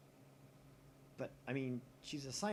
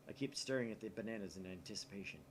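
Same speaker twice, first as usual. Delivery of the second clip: ordinary delivery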